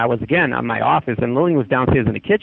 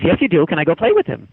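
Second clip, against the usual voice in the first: higher, insistent kid imitation; demanding child; 4 semitones higher than usual. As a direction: lightly act a child insisting. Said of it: "I'm really demanding".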